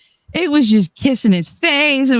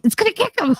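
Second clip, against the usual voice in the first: much higher, alarmed squeal on "It's gonna kick him"; high mock alarm; 11 semitones higher, faster than usual. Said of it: "I was like".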